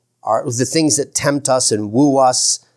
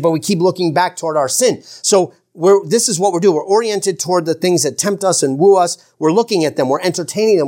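usual speaking voice

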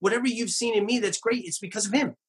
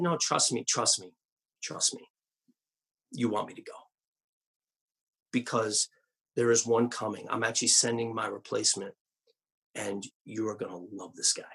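low, soft, hushed reassuring voice; calm them down softly, like a friend leaving; quiet, tender pastor promising a replacement; gentle reassurance; 10 semitones lower, 6 dB quieter than usual. Pretend. Pastor voicing himself reassuring congregation.

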